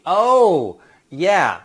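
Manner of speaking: loud, drawn-out dawning realization; sudden recognition. Acted. Staff recalling who threw up last week, sudden recognition. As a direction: let the memory land loudly.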